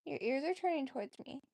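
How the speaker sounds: ordinary talk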